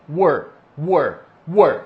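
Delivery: "were" as WUR with English R; simple model "were". Shown as a demonstration. Teacher repeating it for students, "were" as "wur". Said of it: "Simples assim".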